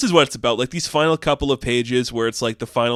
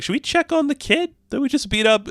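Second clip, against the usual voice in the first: put-on high girlish voice. Reese asks the team.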